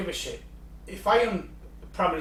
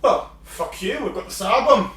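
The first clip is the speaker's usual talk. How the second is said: higher, louder boastful retort; mock defiance; 5 semitones higher, 7 dB louder than usual. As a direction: act out a cocky comeback. Acts out defiant reply to warning.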